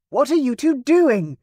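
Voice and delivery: plaintive call. A narrator's reading. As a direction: call out feeling left behind.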